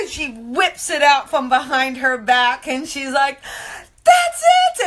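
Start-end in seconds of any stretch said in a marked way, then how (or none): 4.0-4.9 very high, loud squeal; high-pitched excited exclamation, acted out; imitated amazed outburst. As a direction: squeal it like the astonished girl. Girl's amazed reaction, retold.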